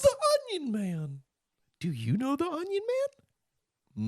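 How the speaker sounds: spook up the mysterious Onion Man; silly high voice. Pretend.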